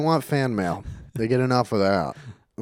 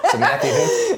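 laughing; 4 dB louder than usual. Real, not acted. Teasing over misheard name.

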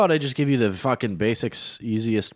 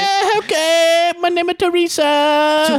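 very high, loud, hammed-up squeal; Chinese lady caricature; 6 dB louder, slower than usual. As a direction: squeal with a cartoonish Chinese accent. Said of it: "So you're a Chinese lady".

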